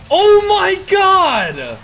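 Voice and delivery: drawn-out, high, loud shout; excited shout. Real, not acted. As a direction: yell it in disbelief. Huge goal from Joris.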